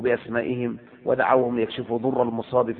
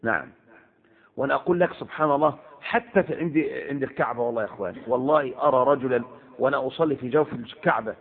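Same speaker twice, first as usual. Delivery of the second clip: normal talking voice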